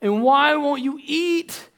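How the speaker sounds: rising, slow pleading questions, building to a near shout; a husband begging his wife to tell him what's wrong. Acted. Elkanah pleading with crying Hannah, rising to a near shout. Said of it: "her husband Elkanah said to her".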